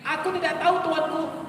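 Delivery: high, trembling servant's voice; frightened soldier. Shown as a demonstration. Soldier pleads ignorance to the king, high and scared. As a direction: squeak out the answer in fear. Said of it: "Pindah sedikit".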